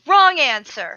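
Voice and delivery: loud, sharp mock scolding; mock stern. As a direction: scold him, half joking. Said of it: "And I said".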